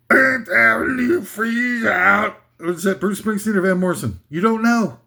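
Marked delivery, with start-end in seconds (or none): 0.1-2.4 slow, high slurred singing impression; rock singer lick. Quiz for listeners: [name] or [name], slow slurred lick. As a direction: slur a lick like a gravel-free rocker. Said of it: "a lick from [name] or ... [name]".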